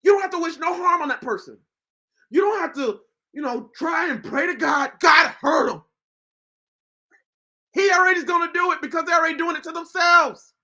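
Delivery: urgent sermon warning the unforgiving; preaching. Acted. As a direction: preach it out at full force. Preaching hard at viewers.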